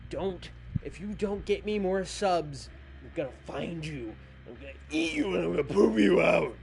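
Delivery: cartoon monster vowing to eat you, loud and high at the end; mock monster threat. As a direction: play a hungry monster threatening the viewers, hammed up. Playful monster threat to viewers.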